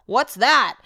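exaggerated puzzled neighbor voice; mock clueless neighbor.

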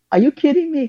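retelling a stunned, high "are you kidding me"; incredulous. Retold or imitated. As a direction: pitch jumps up in disbelief.

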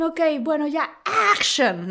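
"action" said slowly in English, not "acción"; director's English "action". Retold or imitated. Director calling action in English, slow English "action". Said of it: "no es acción, es action".

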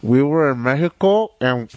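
lisping around a cigar; cigar in mouth. Real, not acted. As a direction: talk with a cigar between teeth. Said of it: "when I have the cigar in my mouth".

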